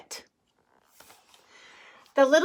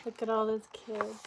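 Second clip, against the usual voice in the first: ordinary delivery, nothing marked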